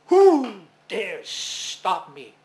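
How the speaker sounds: slow, loud, booming demand; booming sun. Acted. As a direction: roar it slowly like an angry giant. Trapped sun roars at Maui.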